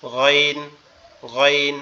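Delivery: গইন said from the back of the throat, gargled; Arabic letter ghain. Shown as a demonstration. Teacher demonstrates the letter গইন. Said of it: "এই গইনটা কেমনে উচ্চারণ হবে".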